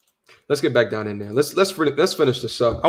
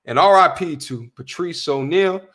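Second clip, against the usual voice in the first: ordinary talk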